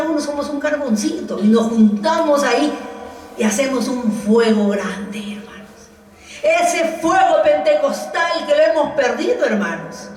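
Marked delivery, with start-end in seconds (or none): 5.2-5.9 whisper. Real, not acted. Whispered tag after a line.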